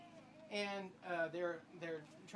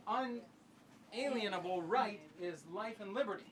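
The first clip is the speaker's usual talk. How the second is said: normal talking voice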